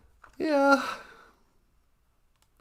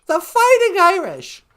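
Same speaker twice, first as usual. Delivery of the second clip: "The fighting Irish!" called out louder and higher, punching "fighting"; mock rallying shout; loud exclamation; 4 semitones higher, 8 dB louder than usual. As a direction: proclaim it like a cheer. Exclaiming the team name.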